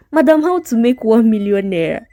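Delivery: a touch of teasing laughter in it; lightly mocking friend; mocking tease. A narrator's reading. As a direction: poke fun at her friend's get-rich video. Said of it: "laughing at Ngozi".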